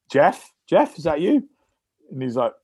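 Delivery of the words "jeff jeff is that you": high-pitched, rising disbelief; incredulous questioning. Retold or imitated. Player suspecting his mate's prank call, mock-incredulous and high. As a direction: query it high, as if onto a prank.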